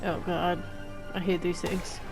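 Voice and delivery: ordinary talk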